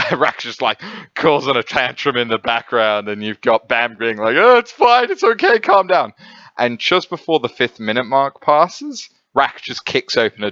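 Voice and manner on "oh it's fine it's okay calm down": high, loud mock-soothing voice; soothing Bam. Bam calming Rak's tantrum, high loud soothing voice. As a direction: pitch it way up to calm someone down.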